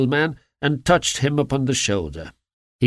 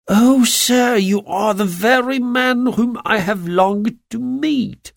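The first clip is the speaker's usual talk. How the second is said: high, eager outburst, slowing; overjoyed, as if he's found his savior; cried out eagerly; 9 semitones higher, slower than usual. A narrator's reading. Little man crying out eagerly to Holmes. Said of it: "cried the little fellow".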